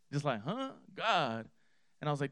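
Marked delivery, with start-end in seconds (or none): 0.4-1.5 puzzled. Retold or imitated. Pastor acting out the seller's reaction to hearing about God, puzzled. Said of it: "he looked at me puzzled".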